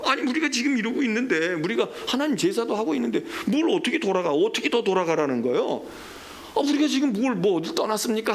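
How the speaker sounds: acted indignant protest, pitched high; high-pitched, argumentative retort, questions piling up; defiant back-talk. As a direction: answer back as the aggrieved people. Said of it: "대꾸를 하고 대들어요".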